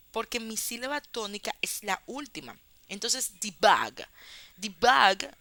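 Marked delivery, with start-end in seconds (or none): 3.4-5.3 "debug" stressed on the second syllable, de-BUG; stress on last syllable. Shown as a demonstration. Teacher giving debug as another example, stress on last syllable. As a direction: hit "bug" hard in "debug". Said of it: "tiene la última también".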